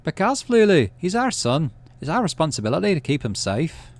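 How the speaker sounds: dad's voice. Acted. Dad pleading in argument.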